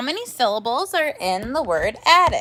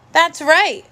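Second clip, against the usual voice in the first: usual speaking voice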